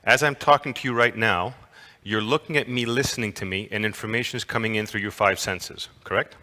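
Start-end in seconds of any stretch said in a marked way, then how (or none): none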